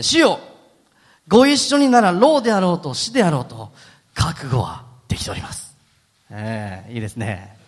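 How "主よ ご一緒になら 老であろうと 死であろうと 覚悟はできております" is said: Peter's bold vow. Acted. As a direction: play Peter swearing loyalty with bravado. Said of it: "こうも言ってるんですね".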